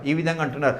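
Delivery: ordinary talk, nothing marked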